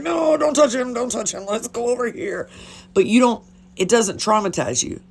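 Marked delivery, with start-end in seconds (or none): 0.0-2.5 high-pitched pleading, easing off toward the end; urgent protective plea. Retold or imitated. Keeping a friend from touching him, high urgent pleading. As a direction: plead with her to come away. Retold steering friend away from body.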